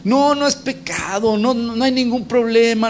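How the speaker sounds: high-pitched mock denial; acted dismissive protest. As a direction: imitate people waving away the warning. Voicing people who dismiss sin.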